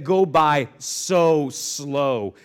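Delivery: so slow, each word stretched; emphatic slow-motion stretch; drawn out. Shown as a demonstration. Stretching words to show slow moments.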